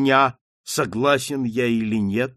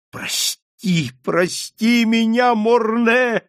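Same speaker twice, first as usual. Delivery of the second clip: high cry for forgiveness, growing louder; 10 semitones higher, 4 dB louder, slower than usual. A narrator's reading. Marquis begging dead Morne for forgiveness, crying out. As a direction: beg and build to a drawn-out cry. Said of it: "закричал маркиз".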